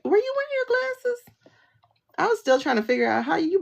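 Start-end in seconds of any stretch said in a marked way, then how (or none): 0.0-1.2 high mocking question about glasses; incredulous mock ask. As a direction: ask it like you can't believe it. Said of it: "Just be like".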